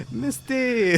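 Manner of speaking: high-pitched mock French accent. Reviving the missed Frenchman for the co-host, high French accent. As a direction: call the name in a comic high French accent. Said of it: "I miss your French accent".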